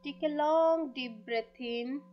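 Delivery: very slow, drawn-out meditation voice; guided meditation. Acted. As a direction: lead the breathing slowly, stretching the words. Leads a breathing meditation. (धीमी, खिंची हुई ध्यान वाली आवाज़)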